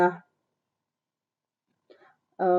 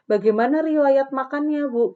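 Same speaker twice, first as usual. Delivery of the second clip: too formal wording; 6 semitones higher, 3 dB louder than usual. Shown as a demonstration. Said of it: "jangan menggunakan bahasa".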